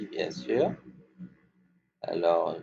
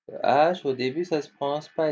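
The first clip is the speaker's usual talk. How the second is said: higher and louder, then fast; emphatic teacher; 6 semitones higher, 4 dB louder, faster than usual. Shown as a demonstration. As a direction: call it out to the class. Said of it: "les enfants".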